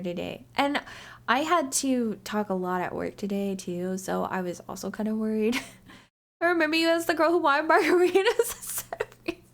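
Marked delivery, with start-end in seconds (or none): 6.0-9.4 high, quick words through laughter; laughing. Real, not acted. Streamer teasing back at friends about margaritas, laughing. Laughing while reading a chat remark.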